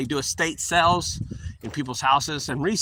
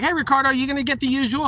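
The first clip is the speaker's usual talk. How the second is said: high, fast friendly barista; chirpy barista; 11 semitones higher, faster than usual. Retold or imitated. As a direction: rattle it off like a cheerful barista. Barista greeting at drive-through.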